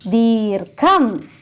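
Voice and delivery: ദീർഘം said very slowly; slow drawn-out word. Shown as a demonstration. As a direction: stretch ദീർഘം out for the class. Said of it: "ഏതാണ്?".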